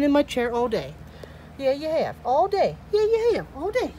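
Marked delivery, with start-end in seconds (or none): 1.6-2.8 playful sing-song to a pet; teasing pet talk. Real, not acted. 2.9-3.9 very high-pitched teasing; squeaky-high playful pet talk. Real, not acted. Repeats the tease in higher pitch.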